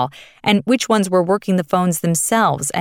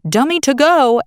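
raised, high-pitched call, a contained yell; mock scream; 7 semitones higher, 4 dB louder than usual. A narrator's reading. Narrator echoes the editor's dreaded command, mildly yelled. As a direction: narrate a shouted newsroom command without full force. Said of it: "someone scream out".

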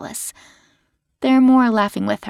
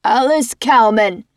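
loud callout of a name; called out; 2 semitones higher, 4 dB louder than usual. A narrator's reading. Unit head calling Alice out from the stage, loud. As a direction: summon her from the stage. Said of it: "Jake yells out".

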